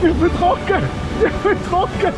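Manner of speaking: high, triumphant shout; excited cheer. Real, not acted. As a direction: cheer that you stayed dry. Cheering after staying dry.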